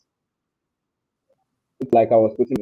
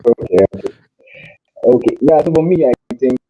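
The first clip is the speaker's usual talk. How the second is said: louder, pushing the voice out; speaking up loud; 3 semitones higher, 8 dB louder than usual. Real, not acted. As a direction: raise the voice to be heard. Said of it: "maybe we'll just have to shout".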